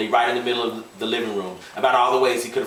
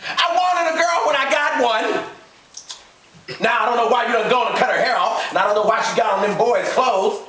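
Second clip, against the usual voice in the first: angry husband; 12 semitones higher, 4 dB louder than usual. A narrator's reading. Gus angry at his wife.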